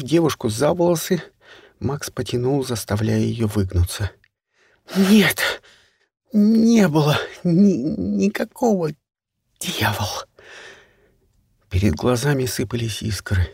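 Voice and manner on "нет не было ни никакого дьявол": high, broken, slow desperate denial; breathless high protest. Acted. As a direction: gasp out the denial, higher. Кай отрицает, задыхаясь. (высокий прерывистый отчаянный протест)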